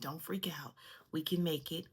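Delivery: breathy whisper, calming herself; whispered self-talk. Retold or imitated. Whispering to herself while the car slips. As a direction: whisper it to yourself to stay calm. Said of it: "And I'm like".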